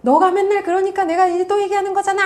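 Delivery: acted high-pitched nagging; nagging parent. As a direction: nag the child in a high, exasperated voice. Acted nagging you-message to child.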